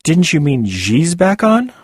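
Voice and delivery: slow, high teasing rise. Acted. Narrator teasing Eargarden about her word choice. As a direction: tease her gently with the question. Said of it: "I teased".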